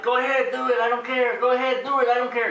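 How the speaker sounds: high mock thick accent; new Kermit. Imposter frog waving the Muppets on, thick mock accent. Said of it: "he had a thick accent".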